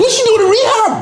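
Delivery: very high, squeaky outcry; incredulous squeal. Real, not acted. Host questions why a teen is in rehab, incredulous squeal. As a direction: voice jumps sky-high in disbelief.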